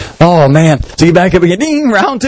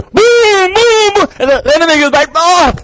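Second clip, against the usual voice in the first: very high excited booms; climactic blows. Retold or imitated. Preacher acting Rocky's winning punches, very high. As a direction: hit every boom up high.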